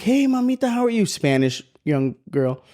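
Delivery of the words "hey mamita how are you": put-on high, sing-song hello to a toddler; gentle child-directed greeting. Officer greets a lost little girl, gently high-pitched. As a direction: imitate coaxing a little kid.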